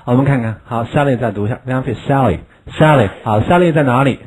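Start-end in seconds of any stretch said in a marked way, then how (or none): none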